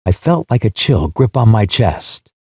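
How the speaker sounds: ordinary delivery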